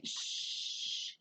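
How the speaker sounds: put-on a soft hissed shh, like hushing a child; shushing sound. Shown as a demonstration. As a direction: hush them with a long shh. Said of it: "like I'm telling you to be quiet".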